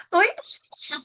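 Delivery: sharp high startled "what are you doing"; startled scolding. Retold or imitated. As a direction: snap at the kids in shock. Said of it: "너무 놀란 상황에서 이제 아이들을 다그친 거예요". (놀라서 높게 다그치듯)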